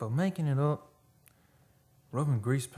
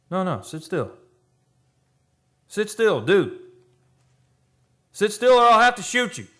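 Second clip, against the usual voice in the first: rising from firm warning to a high, loud yell; louder and higher each time, ordering him to sit still; threatening shout; 9 semitones higher, 11 dB louder than usual. Acted. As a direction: build from a stern no to a panicked scream at the gun. Gunman threatening a frightened man.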